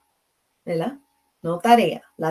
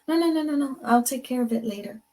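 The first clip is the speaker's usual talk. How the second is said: dismissive refusal; 5 semitones higher, faster than usual. Retold or imitated. Refusing offered painkillers.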